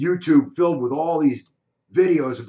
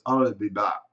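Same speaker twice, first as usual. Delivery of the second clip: put-on low Austrian-accented I'll be back; heavy Austrian accent; 8 semitones lower than usual. Imitating the Terminator for his cohost, low Austrian accent. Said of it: "he has that heavy accent".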